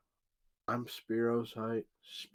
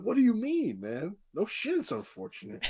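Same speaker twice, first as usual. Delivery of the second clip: exasperated retort; 10 semitones higher than usual. Retold or imitated. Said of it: "I'm like".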